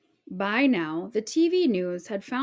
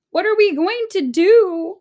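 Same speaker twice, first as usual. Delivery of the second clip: much higher, louder desperate cry; a panicked mother crying out; distraught cry; 11 semitones higher, 9 dB louder than usual. A narrator's reading. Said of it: "cried Missus Cream".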